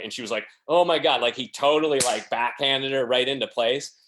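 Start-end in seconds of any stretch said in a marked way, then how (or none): 0.7-1.2 higher, louder shocked exclamation; stunned gasp. Retold or imitated. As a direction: caught out and taken aback. Reporter shocked by the rebuke.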